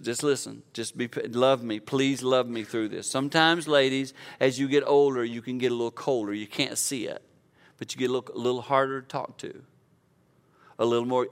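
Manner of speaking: ordinary delivery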